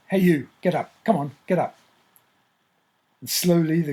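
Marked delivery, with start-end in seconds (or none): 0.1-1.8 calling loudly to wake him. Retold or imitated. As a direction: rouse a sleeping man, loudly. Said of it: "He says to it very loudly".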